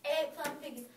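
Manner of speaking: plain reading voice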